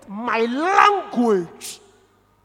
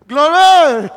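loud exclamation; 4 semitones higher, 16 dB louder than usual. Real, not acted. Preacher shouting praise to the church, loud.

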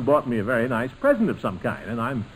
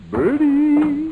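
higher, louder call into the kitchen; 7 dB louder than usual. Real, not acted. Uncle calling the cook from the next room, loud call. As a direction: call her name across the house.

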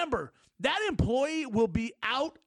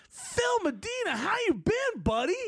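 cheerful fake friendly greeting; 9 semitones higher than usual. Manager greets him in store.

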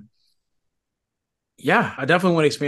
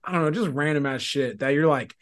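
normal talking voice

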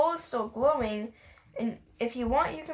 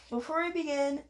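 ordinary talk

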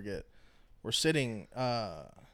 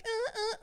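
high mock moaning "uh, uh", mimicking a friend; retold friend on the couch, mock moaning. Telling how Isaac moaned at the door, high mock moans. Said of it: "like, moaning shit".

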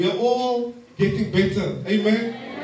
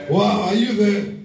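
ordinary talk, nothing marked

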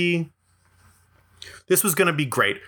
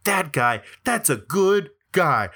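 slow, lightly voiced awe; imagined admirers; slower than usual. Onlookers watch his good deed, imitated slow admiration. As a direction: let each word linger in mild, imitated amazement. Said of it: "they're gonna be like".

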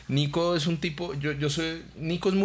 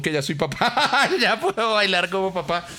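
laughing pride; 8 semitones higher, 4 dB louder than usual. Real, not acted. Proud new dad, laughing.

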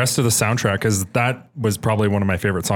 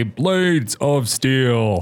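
slow, drawn-out game announcer imitation; game voice sample; 5 semitones higher, much slower than usual. Teasing a friend about early game voices, game voice sample. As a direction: announce it like an old arcade title.

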